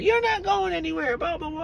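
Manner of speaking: high mocking voice of the girlfriend. Mocking the girlfriend blocking him in the shower, high mock voice. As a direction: squeak her words up high to mock her.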